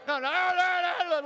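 loud, high arguing shouts; crowd uproar. Shown as a demonstration. Demonstrating the confused crowd for the congregation, noisy arguing. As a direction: go nuts like a rowdy crowd. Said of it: "start going nuts and making lots of noise".

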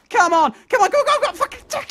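very high, squealed urging; high-pitched, frantic outburst; frantic frustration. Real, not acted. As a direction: urge it on desperately at top pitch. Frantic failed climb.

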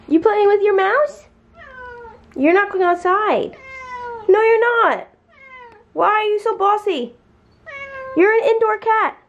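high-pitched pet talk to her cat, mock bossy. Real, not acted. Scolding her cat playfully.

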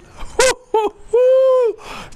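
high, loud cackling laugh; gloating laugh. Real, not acted. Cackles after scoring.

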